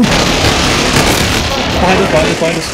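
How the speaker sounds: loud, high callout; urgent warning shout. Real, not acted. Warning teammates of enemies behind them, urgent shout. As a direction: yell it out to warn the squad.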